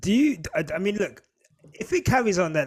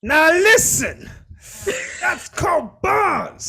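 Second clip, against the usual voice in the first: much higher, louder, slow hammed hype; hype man; 13 semitones higher, 8 dB louder, much slower than usual. Host hypes friend's honey-badger line, loud slow mock hype.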